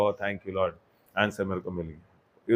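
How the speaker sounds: low, hushed re-enacted thanks; quiet thankful prayer. Believer thanking the Lord on finding an answer, quiet acted prayer.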